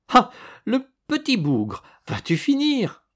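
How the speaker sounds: high, squealing laughing protest; being tickled. A narrator's reading. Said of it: "Elle rua dans l'air en rigolant". (voix aiguë d'une fille qu'on chatouille)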